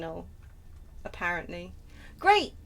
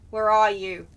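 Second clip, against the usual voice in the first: louder, lower call to someone hidden; 3 semitones lower, 7 dB louder than usual. Real, not acted. Player hunting a hidden vampire, calling out.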